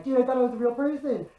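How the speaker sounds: amazed. Retold or imitated. Another guard amazed by the doll.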